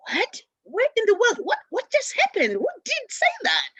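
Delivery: retold outraged shock, pitched high. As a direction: react as if you can't believe your ears.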